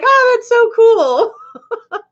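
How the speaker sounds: high, slow, gushing and laughing; slow high excited coo through a laugh; gushing impression. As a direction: coo it like an impressed American fan. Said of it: "they're like".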